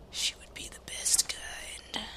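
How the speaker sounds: soft breathy whisper; whispered aside. Real, not acted. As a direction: let it slip as a secret.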